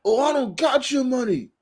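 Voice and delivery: high, agitated retold denial; high-pitched defensive imitation; mock spazzing. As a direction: imitate a worked-up boss brushing someone off. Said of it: "he was spazzing and all that".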